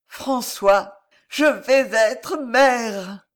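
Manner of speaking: high, slow cry of pure joy; ecstatic announcement. A narrator's reading. Jeanne announcing motherhood to François, ecstatic cry. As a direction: proclaim it, drawn out and rapturous. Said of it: "le sentiment qu'elle traduisit dans un adorable sourire".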